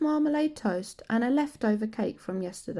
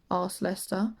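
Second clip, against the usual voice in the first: usual speaking voice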